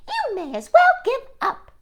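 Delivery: sing-song high bird mockery; jeering robin voice. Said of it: "said the robins".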